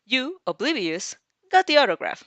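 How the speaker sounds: a playful misreading aloud; misread, amused. Real, not acted. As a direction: stumbles brightly over the misreading. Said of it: "No, oblivious".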